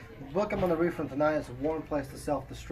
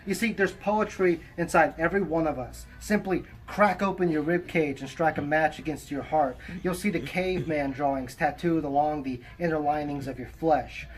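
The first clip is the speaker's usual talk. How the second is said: poem recitation; 3 semitones higher, 5 dB louder than usual. Acted. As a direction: recite it with measured pauses between phrases. Reads own poem aloud.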